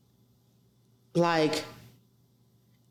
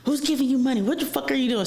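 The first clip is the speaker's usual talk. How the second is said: raised-pitch indignant demand; retold angry questioning; 7 semitones higher, faster than usual. Boyfriend grilling her about the money, indignant. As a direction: imitate him demanding answers, higher.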